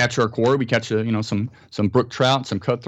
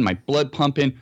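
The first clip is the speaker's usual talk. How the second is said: usual speaking voice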